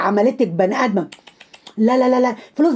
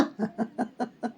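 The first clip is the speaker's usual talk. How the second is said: short laugh; 16 dB quieter than usual. Real, not acted. Laugh after mocking taunt.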